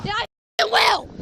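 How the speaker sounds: loud, furious shout; defiant yell. Real, not acted. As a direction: fire it back defiantly. Woman yelling at officer.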